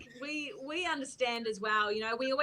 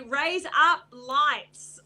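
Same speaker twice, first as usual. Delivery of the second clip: slow, deliberate, louder delivery of the phrase; drawn-out recital; 3 semitones higher, 7 dB louder, much slower than usual. Shown as a demonstration. Asked by the chat to say it, said slowly and carefully. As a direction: stretch each word out on request. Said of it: "yes, I can say".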